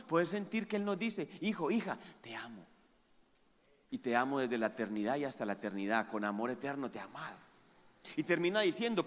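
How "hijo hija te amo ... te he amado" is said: soft, lower, intimate; tender voice of God. Acted. Pastor voicing God speaking to His children, soft and tender. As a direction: speak it like a loving father close by.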